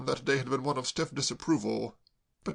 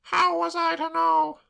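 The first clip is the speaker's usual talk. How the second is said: put-on much higher, slow wretched girl; tearful girl; 6 dB louder, slower than usual. Claire sobbing to Braddock, high tearful wail.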